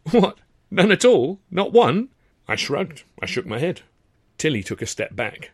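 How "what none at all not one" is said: much higher, loud astonished squeal; a showgirl appalled he's never been on stage; shocked disbelief. A narrator's reading. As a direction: gasp it out, scandalised. Tilly shocked.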